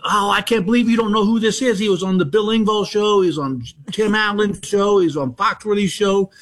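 put-on high-pitched gushing older fan; starstruck fan. Starstruck fan insists to coworker.

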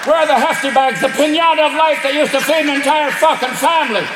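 an Irish raccoon fuming over the recycling. Acted. Raccoon berating the homeowner over recycling, Irish rant. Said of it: "I don't know why I made the raccoons Irish".

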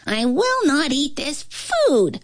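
put-on high, slow childish refusal; a spoiled little king digging in his heels; petulant boy-king voice, drawn out; stubborn young king. A narrator's reading. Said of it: "the king said".

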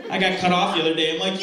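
normal talking voice

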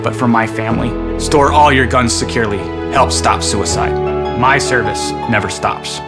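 normal talking voice

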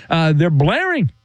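ordinary talk, nothing marked

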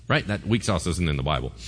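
ordinary talk

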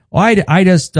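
high, eager dad voice; imitating dad. Son imitating his dad on the phone, high and eager. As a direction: play the tipsy dad starting his tale.

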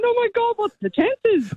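high, delighted mock squeal of disbelief; excited squeal. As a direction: relive the shock of bumping into family. Retold squealing reunion in pub toilets.

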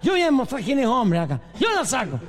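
put-on high-pitched boastful drunk; blustering drunk. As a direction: insist high and cocky, hammed-up drunk. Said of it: "Y un borracho dice". (borracho fanfarrón, voz aguda)

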